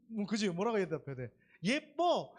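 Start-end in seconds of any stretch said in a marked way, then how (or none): 1.6-2.3 eager answer. Shown as a demonstration. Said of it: "무조건 이렇게 대답하는 거야".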